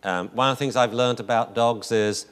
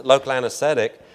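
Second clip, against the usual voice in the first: usual speaking voice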